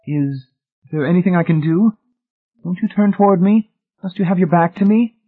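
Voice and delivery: high, urgent questions, rising in pitch; anxious pleading. A narrator's reading. Wife appealing to husband stuck on the stairs, anxious pleading. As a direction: plead for a way to help, voice climbing.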